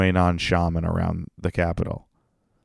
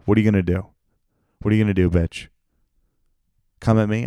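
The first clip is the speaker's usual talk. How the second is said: defiant taunt; 6 dB louder, faster than usual. Pretend. Defiant taunt at imagined critics.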